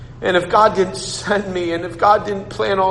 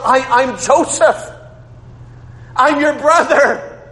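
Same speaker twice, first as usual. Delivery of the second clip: slow, higher, tender reveal; Joseph revealing himself; 7 semitones higher, slower than usual. Retold or imitated. Joseph telling his brothers who he is, slow and high. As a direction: let it out slowly like a long-lost brother.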